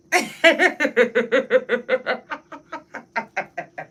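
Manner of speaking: long high laugh. Real, not acted. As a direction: break up laughing at the joke. Laughs at her mock crying.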